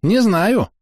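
high little cricket voice; excitable cricket; chirping cricket. A narrator's reading.

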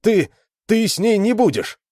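high, loud, stammered objection; agitated outcry; heated protest. Acted. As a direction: burst out in protest, pitch shooting up. Alan protests over losing Lita.

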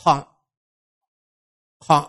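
"Khạ" said far back in the throat, rounded toward khọ; back-of-throat sound. Shown as a demonstration. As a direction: model "Khạ" as a short back-throat syllable. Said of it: "phụ âm kép mà sau họng này". (đọc mẫu "Khạ" như "khọ", âm sau họng)

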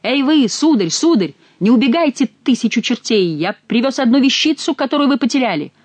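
shouting at full voice. A narrator's reading. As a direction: shout after them to stop. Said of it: "крича во весь голос".

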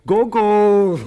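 high, loud shout of the name; full shout across the stage. Acted. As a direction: bellow the name to wake him. Shouting to wake him.

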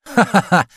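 high-pitched "ha-ha-ha"; acted laugh. Meitei laughs at the host's evasiveness, high acted laugh. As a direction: burst into a high mocking laugh.

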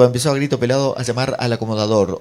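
normal talking voice

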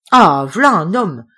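loud, slow, delighted cry of approval; enthusiastic. A narrator's reading. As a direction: hail him like a hero. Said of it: "reprend d'enthousiasme Hubert".

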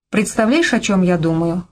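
ordinary delivery, nothing marked

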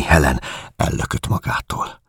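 ordinary delivery, nothing marked

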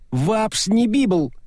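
muffled cursing through a tube; garbled angry words down a pipe; muffled swearing. Acted. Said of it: "приглушённый поток ругани". (приглушённая ругань через трубу)

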